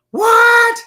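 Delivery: high squeal of surprise; surprised shriek. Real, not acted. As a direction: shoot way up on a startled what. Surprise at leaving date.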